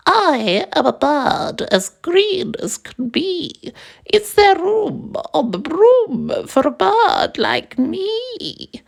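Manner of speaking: very high whispery bird voice; a tiny breathy bird asking; squeaky little bird pleading. A narrator's reading. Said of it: "and said to the witch".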